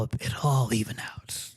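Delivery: low whispered Negan menace. Acted. Podcast host briefly channels Negan, low hushed menace. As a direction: slip into a hushed Negan growl. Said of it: "I became Negan there for a second".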